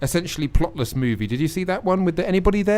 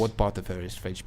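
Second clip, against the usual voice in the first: ordinary delivery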